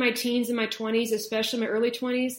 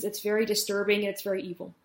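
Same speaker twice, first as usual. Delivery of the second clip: usual speaking voice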